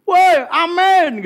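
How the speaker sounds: mock yell of a church uncle; shouting. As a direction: holler it across the hall. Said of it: "Lihat teriak gini".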